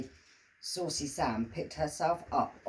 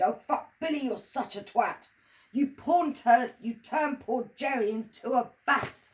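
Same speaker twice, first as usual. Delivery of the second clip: high, angry scolding shout; 7 semitones higher, 4 dB louder than usual. Acted. Emma yelling at Billy for turning Jerry into a bat, high. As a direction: lay into him. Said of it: "Emma screamed and shouted".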